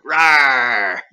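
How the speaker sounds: loud animal roar. Acted. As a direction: let out a big growling roar. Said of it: "The werewolves?".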